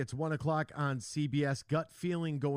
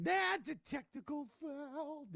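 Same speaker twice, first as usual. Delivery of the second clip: referee call; 12 semitones higher, 3 dB quieter, slower than usual. Retold or imitated. Coach voice on the screen.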